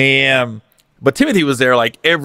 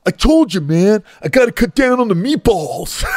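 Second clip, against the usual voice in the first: out of breath mafia guy; 6 semitones higher than usual. Retold or imitated.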